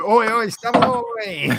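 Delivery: retold chant, drawn out a little; cheeky fish-shop call of the snack's name; mock shout. Recalling fish shop workers, a cheeky chanted call. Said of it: "one or two of us would just go".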